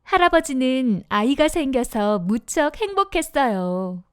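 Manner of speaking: ordinary delivery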